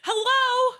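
very high, loud, startled hello; stunned hello. Retold or imitated. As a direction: call out as if nobody is listening.

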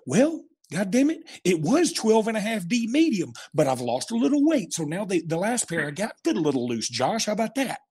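lightly exasperated, high, justifying himself; mock defensive bluster. Dad telling Josh his shoe size, mock defensive bluster.

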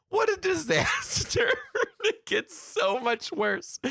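high, squeaky voice breaking into laughter; real laughing dismay. Real, not acted. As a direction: wail it through helpless laughter. Laughing at own disaster story.

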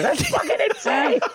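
high, far-off mock movie yell; hammed-up screaming impression of the alert; Keanu Reeves scream. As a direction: shriek it like the alert clip. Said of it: "Keanu Reeves screaming".

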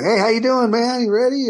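high, bright sing-song greeting; cheery Eddie greeting. Retold or imitated. Eddie greeting him on the golf morning, high and chirpy. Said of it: "and he goes".

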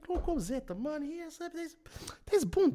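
high, mostly soft mock apology; apologetic chummy policeman. Policeman excusing a fine to his friend, apologetic mock-chummy. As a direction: play the fawning cop in a high voice.

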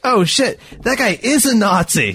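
high, hammed-up "oh shit" of alarm; imitating a sudden scared realization; startled realization. Realizing the Nazi heard him.

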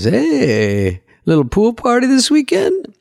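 high-pitched, slightly German waiter voice; dubious German accent. Retold or imitated. Waiter teasing a regular about pool photos, high off-target German accent. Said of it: "Is this your German accent?".

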